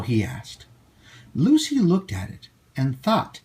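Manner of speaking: ordinary delivery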